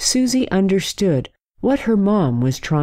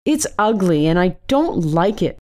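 plain reading voice

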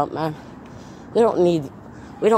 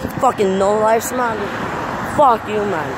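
furious yelling rant; 4 semitones higher than usual. Real, not acted. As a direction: shout them down in real anger. Ranting furiously at online haters.

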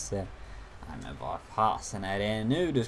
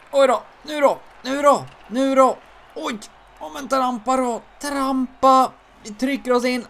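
much higher, loud frantic shouts; frantic yelling; 11 dB louder than usual. Real, not acted. Gamer yelling at his skater to push forward. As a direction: yell urging the attack on. Chaotic attack in game.

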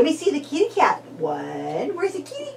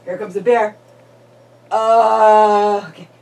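ordinary talk

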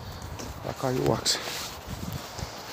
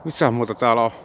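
talking around a cigarette; cigarette in mouth; 2 semitones lower than usual. Real, not acted. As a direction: lips clamped on cigarette. Seen 4: Cigarette held between lips throughout; reference frames show none.

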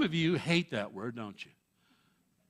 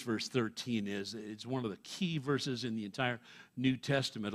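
ordinary talk

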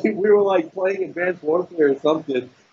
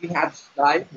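imitating; 9 semitones higher than usual. Mimicking him.

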